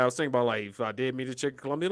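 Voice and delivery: ordinary talk, nothing marked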